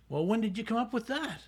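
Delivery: high-pitched retold protest; mock incredulous challenge; exasperated disbelief. Coordinator exasperated at late request, retold.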